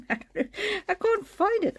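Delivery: high, drawn-out laughing protest; amused, squealing upward in disbelief; laughing exasperation. Real, not acted. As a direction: giggle helplessly through the words. Laughs while searching for lost card.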